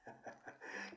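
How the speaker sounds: quiet, voiceless little laugh; soft chuckle. Real, not acted. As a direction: chuckle softly at an absurd idea. Scoffs at the Aryan race idea.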